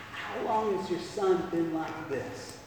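Jesus gently asking the father; calm voice. Acted. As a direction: ask with quiet compassion. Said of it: "in a calm voice".

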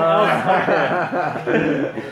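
slow words breaking into laughter; can't hold the laugh delivering the punchline; laughing through punchline. A narrator's reading. Preacher reaches the joke's end to his listeners, laughing.

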